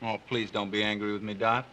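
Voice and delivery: soft, pleading and careful; walking on eggshells. Acted. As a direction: coax her gently, afraid to upset. Husband placates angry wife.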